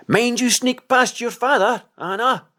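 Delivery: high, loud call across a distance; calling from afar. A narrator's reading. Simeon calling to Anna from afar, loud and high. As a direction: shout it out to someone far away. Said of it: "cried Simeon afar off".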